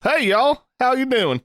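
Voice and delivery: high, playful Southern drawl greeting; high-pitched mock Southern hello; Southern accent. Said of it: "resort to a fucking Southern accent".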